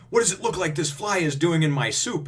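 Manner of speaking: high, loud demanding diner; mock indignation. Diner pointing at a fly in soup, mock indignant. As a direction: act out an outraged question.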